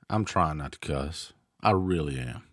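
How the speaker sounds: ordinary talk, nothing marked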